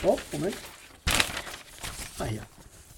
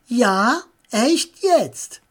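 louder, higher cocky reply; triumphant; 6 semitones higher, 6 dB louder, much slower than usual. A narrator's reading. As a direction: throw his words back at him. Tommy trumpft gegenüber Paul auf. (laut und triumphierend)

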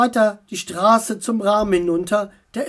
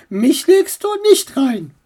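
higher, louder scolding; aunt scolds the raven-uncle; 7 semitones higher, 6 dB louder than usual. Acted. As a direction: tell him off like a cross aunt.

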